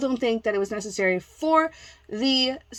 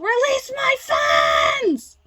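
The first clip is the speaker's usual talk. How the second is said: high, loud, drawn-out shriek; hammed-up screech, stretching each word; mock yelling; 5 dB louder, much slower than usual. Mimicking seller yelling at Poshmark, mock shriek. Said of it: "you're yelling at Poshmark too".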